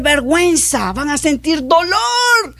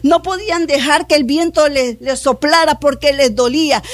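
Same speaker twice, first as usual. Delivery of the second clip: usual speaking voice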